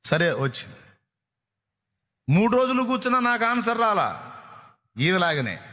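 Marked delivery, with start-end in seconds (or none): 2.3-4.4 whiny quoted complaint, pitched high; preacher mimics a believer who gave up after three days, whining; mock complaining believer.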